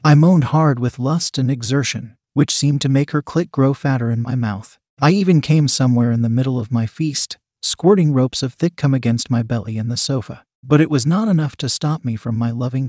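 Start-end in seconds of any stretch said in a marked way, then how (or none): none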